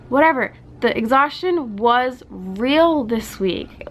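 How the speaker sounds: normal talking voice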